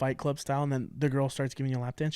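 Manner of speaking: usual speaking voice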